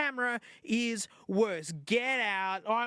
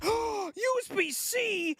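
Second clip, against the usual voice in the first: excited shout; 10 semitones higher than usual. Real, not acted. Unboxing the phone for viewers, thrilled shout. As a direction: cry out "U S B C" like it's a miracle. Discovers USB C port.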